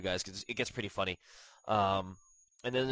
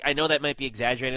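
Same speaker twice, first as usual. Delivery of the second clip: normal talking voice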